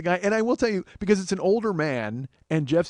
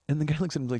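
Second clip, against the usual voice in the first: usual speaking voice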